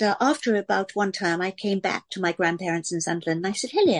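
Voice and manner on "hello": imitated upper-class English greeting; newly acquired upper-class English accent. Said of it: "the sort of upper class voice".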